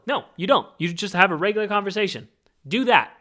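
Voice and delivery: ordinary talk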